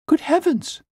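very high startled exclamation; frightened cry. A narrator's reading. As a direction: gasp it out in sudden fear. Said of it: "she cried".

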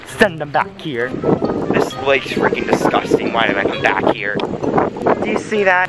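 ordinary talk, nothing marked

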